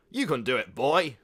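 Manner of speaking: loud, hearty encouragement; encouraging uncle. A narrator's reading. Creepy uncle encouraging his nephew loudly. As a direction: pump the boy up. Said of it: "he encouraged".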